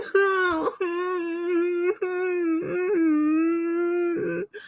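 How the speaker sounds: very high, long mock wail; hammed-up crybaby keening, held and thin; fake crying. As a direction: pretend to sob in a sustained high whine. Pretends to cry over hurt feelings.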